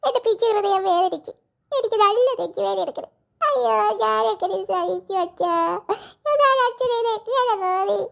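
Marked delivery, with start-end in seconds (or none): 3.4-8.0 slow, wailing sob over the words; mock crying child. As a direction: whine it out like a hurt little girl. Child wails over ice cream.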